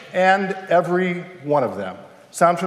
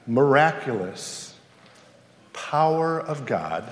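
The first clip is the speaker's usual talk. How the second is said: hushed reverence; 5 semitones lower, slower than usual. Acted. Quiet reverent preaching.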